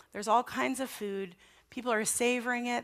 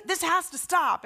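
higher, insistent mock telling-off; retold scolding; 7 semitones higher, 4 dB louder than usual. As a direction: re-enact laying down the law to a child. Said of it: "I was yelling at him".

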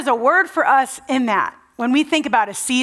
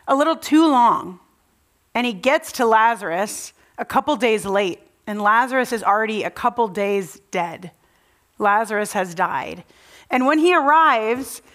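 normal talking voice

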